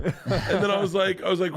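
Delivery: ordinary delivery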